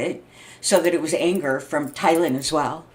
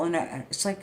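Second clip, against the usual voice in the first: ordinary delivery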